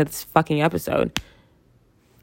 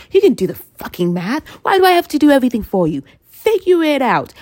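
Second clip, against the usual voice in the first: higher, louder, fast scolding; mock angry rant; 8 semitones higher, 4 dB louder than usual. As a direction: scold the viewers in mock outrage.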